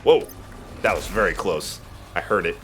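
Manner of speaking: ordinary talk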